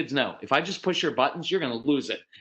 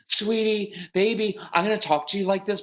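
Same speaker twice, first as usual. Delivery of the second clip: higher, sugary parent voice; really sweet tone; 7 semitones higher than usual. Shown as a demonstration. Parent afraid to give a direction, sugary sweet. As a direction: exaggerate the sweetness. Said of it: "in a really sweet tone".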